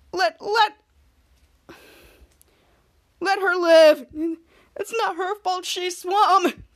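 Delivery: pleading, slow, with a cry in the voice; hammed-up crying plea; mock tearful plea. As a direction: beg the sky through fake tears. Pleading to the sky for her.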